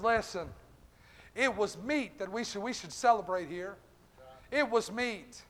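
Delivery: a father gently calming his angry son; tender father. Acted.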